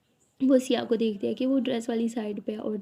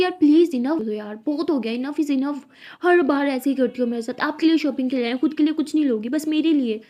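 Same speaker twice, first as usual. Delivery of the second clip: loud, fed-up outburst at her partner; angry outburst; 3 semitones higher, 6 dB louder than usual. Acted. Angry outburst at partner over shopping. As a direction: snap back loudly, enough is enough. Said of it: "चिल्ला क्यों रही हो?".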